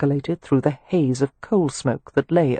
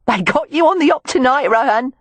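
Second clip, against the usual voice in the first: much higher, louder Cockney jeer; amused woman teasing Rohan mid-fight; Cockney exclaim; 9 semitones higher, 3 dB louder than usual. Acted. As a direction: crow at him with glee, Cockney tart. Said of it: "she exclaimed".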